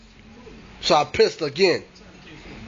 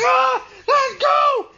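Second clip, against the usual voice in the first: terrified shout; much slower than usual. Retold or imitated. Boy yelling at the ghost gripping his foot. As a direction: shriek to make her let go. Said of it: "I'm like".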